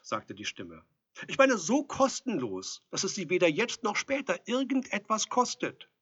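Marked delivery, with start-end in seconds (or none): 1.3-5.9 high, loud protest from outside; insistent calling. A narrator's reading. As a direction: insist loudly through the door. Visitor calls through the door.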